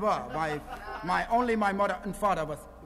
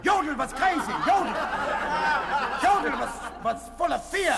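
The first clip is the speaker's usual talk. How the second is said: ranting general; 6 semitones higher than usual. Pretend. Fake planter rants about the war to the interviewer. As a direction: rant about your generals.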